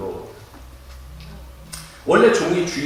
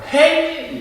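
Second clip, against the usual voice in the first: loud, high-pitched English call; acted cry for help; 12 semitones higher, 6 dB louder, much slower than usual. Drowning person calls for help in English, acted shout. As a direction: shout it out for rescue. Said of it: "예, 영어로".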